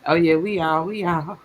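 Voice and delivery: normal talking voice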